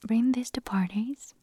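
ordinary talk

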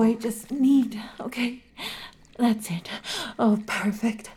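normal talking voice

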